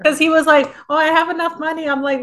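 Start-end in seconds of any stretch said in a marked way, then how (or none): 0.9-1.9 high, mocking character voice; a lightly voiced smug guy, pitched up; mock quoting character. Mimicking the character bragging about money, high mocking voice.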